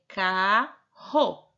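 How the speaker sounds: carro drawn out slowly; slow sounding out. Shown as a demonstration. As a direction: stretch carro for the syllable split. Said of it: "você vai colocar aqui a sílaba".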